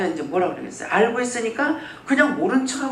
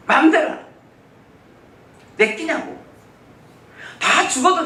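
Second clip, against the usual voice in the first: sharp loud yell, higher; retold shouting; 7 semitones higher, 6 dB louder than usual. Pastor reenacts yelling at God in frustration, loud. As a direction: shout it out in exasperation. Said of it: "나중에 소리를 지르죠".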